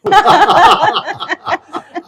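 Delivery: loud high burst of laughter; hearty laugh. Real, not acted. Guest laughing loudly at his own joke to the host. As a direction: let a big genuine laugh break out.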